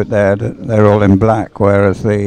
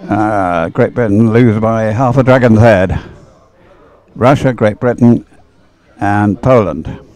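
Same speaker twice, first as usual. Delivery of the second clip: louder, building live call of the final hundred meters; race commentary; 2 semitones higher, 4 dB louder than usual. Real, not acted. Club commentator calling the dragon boat finish to the crowd, louder and building. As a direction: call the finish as the boats close in, rising to it.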